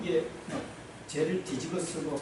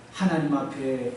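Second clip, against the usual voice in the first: normal talking voice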